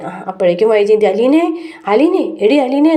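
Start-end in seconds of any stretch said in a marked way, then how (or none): 1.1-2.9 retold call across the house, higher; mock calling for Aleena from another room. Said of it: "ഇങ്ങനെ വിളിച്ചുകൊണ്ടിരിക്കുകയാണ്". (ഉറക്കെ വിളിക്കുന്നത് അനുകരിച്ച്)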